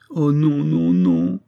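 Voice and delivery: anguished refusal, starting high and sinking; moaning cry. A narrator's reading. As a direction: cry out in protest. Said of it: "avec un faible gémissement".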